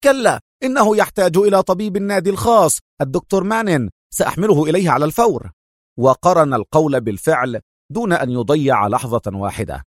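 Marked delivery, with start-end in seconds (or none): 0.0-5.5 firm refusal, pitch raised. A narrator's reading. Adham overruling club members about the doctor, firm. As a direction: insist decisively, higher-pitched. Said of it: "قال أدهم في حزم".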